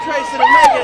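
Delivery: shouting names over the crowd; calling out. Real, not acted. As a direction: call out loud above the noise. Reporter calling over walk crowd.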